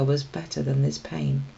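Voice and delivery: plain reading voice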